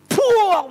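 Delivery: high, loud "puak" impact noise; explosive mimicked hit; imitated blow. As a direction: burst out the smack sound.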